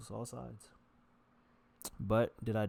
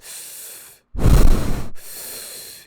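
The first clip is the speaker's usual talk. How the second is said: loud wheezing exhale; heavy loud sighing breath; 21 dB louder than usual. Shown as a demonstration. Showing how he breathed at three hundred pounds, wheezing. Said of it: "that's how I would breathe normally".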